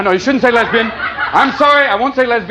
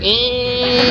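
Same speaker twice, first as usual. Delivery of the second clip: squeaky ee. Retold or imitated. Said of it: "You just heard".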